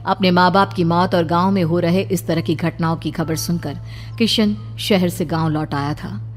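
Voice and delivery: ordinary delivery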